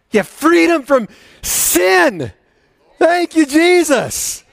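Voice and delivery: loud, high, slow proclamation; rising praise cry; full-force preaching. Acted. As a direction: proclaim it like an altar call.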